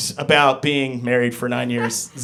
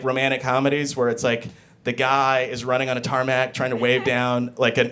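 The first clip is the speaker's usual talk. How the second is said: ordinary talk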